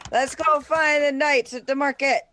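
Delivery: high, loud and eager; rally everyone to go; excited. Real, not acted. Excited joke call.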